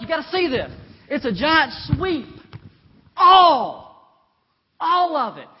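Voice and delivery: loud, high, building pulpit cry; full-force preaching. Acted. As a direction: build the verse to a huge, booming ALL.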